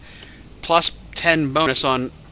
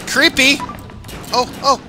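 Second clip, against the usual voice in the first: creeped out yelp; 10 semitones higher, 3 dB louder than usual. Real, not acted. Reacts to characters waving.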